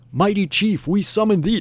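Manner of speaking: high-pitched mild mock yell; imitated summoning yell. Crowd summoning the chief, a mild imitated yell. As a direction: call it out like a summons, half-voiced. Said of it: "they're gonna yell something out in Vietnamese".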